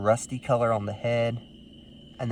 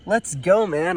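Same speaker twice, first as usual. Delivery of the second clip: a high, louder cry of joy; excited cheer; 7 semitones higher, 3 dB louder, slower than usual. Real, not acted. Finding his main target at last, higher excited cheer. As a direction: let the thrill spill over.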